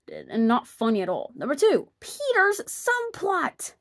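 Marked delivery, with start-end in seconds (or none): 1.3-3.7 mock announcement.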